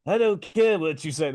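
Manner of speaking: a hint of a put-on [name] twang; lightly mock the Oscar clip; Chalamet's [name]. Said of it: "he was like".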